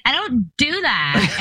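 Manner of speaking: slow, indignant "I don't do that."; mock-indignant daughter; teen daughter denying it. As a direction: protest like an offended teen. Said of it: "she was like".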